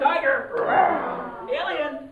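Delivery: high loud cues; called out loud. Shown as a demonstration. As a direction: shout cues to the crowd. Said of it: "we're gonna do this all together".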